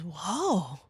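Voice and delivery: ordinary talk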